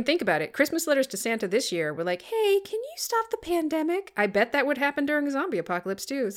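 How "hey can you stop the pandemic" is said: put-on high child letter-writer voice; high, sweet little-kid pitch. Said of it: "your little kid voice is really creepy".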